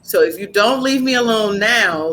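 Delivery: ordinary delivery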